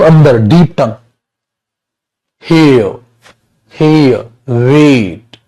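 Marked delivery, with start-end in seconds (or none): 3.7-5.4 हे, वेट very slow with the tongue pulled back; teacher demoing a deep tongue sound. Shown as a demonstration. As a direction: pull the tongue back and breathe out on हे. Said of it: "टंग को आप अंदर खींच के".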